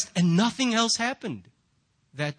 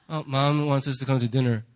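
low, level, unbothered reply; unfazed kid; 7 semitones lower than usual. Acted. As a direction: shrug off mom's yelling, much lower. Kids unfazed by mom's screaming.

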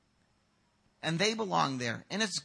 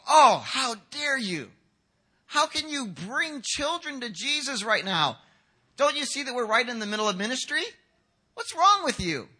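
higher-pitched, outraged rebuke; indignant scolding; 5 semitones higher, 4 dB louder than usual. Acted. Disciples rebuking parents who bring their kids, indignant scolding. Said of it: "or kind of yelled at".

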